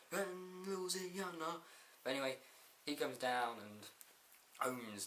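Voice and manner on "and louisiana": high, slow, stretched-out impression; mock wrestler proclamation. The Rock proclaims himself the people's champ, hammed-up and drawn-out. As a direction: hold every syllable like a showman. Mimics The Rock's drawn-out delivery.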